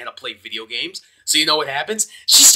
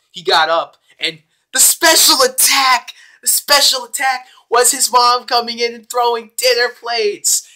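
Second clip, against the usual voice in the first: excited telling; 9 semitones higher, 6 dB louder than usual. Real, not acted. Excited climax retelling.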